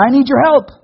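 high, pleading call for help; pleading cry. Acted. Starving woman calling to the king, high pleading cry.

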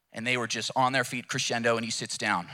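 ordinary talk, nothing marked

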